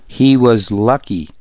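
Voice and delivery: ordinary delivery, nothing marked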